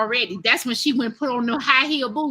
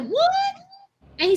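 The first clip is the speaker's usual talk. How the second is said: high, shocked squeak of disbelief; a stunned girl's high-pitched what. Retold or imitated. Shocked reply to insult.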